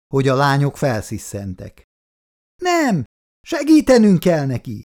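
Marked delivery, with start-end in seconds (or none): 2.6-4.8 high, loud frenzied yell; frantic shout. A narrator's reading. Daughter fights her father's grip, frantic high shout. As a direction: scream it while struggling free. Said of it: "kiabálta a nagyobbik lánya eszelősen".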